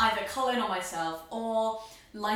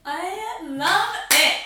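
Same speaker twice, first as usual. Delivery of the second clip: delighted; 9 semitones higher, 10 dB louder, much slower than usual. Real, not acted. Delighted reaction to reading.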